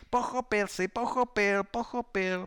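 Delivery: high, loud jeering chant; mocking shouts. A narrator's reading. Classmates venting envy on the pupil, jeering. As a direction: taunt loudly in a high voice. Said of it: "pokřikovali na něj posměvačně".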